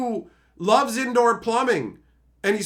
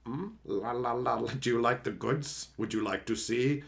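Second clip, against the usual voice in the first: creepy low seller; 9 semitones lower, 8 dB quieter than usual. Acted. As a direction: lure them in like a shady peddler. Sleazy seller voice.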